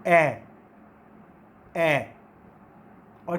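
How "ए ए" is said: ए held long, lower and louder; long ae vowel. Shown as a demonstration. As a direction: demonstrate the vowel ए clearly. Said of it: "इसकी sound किस तरह से है".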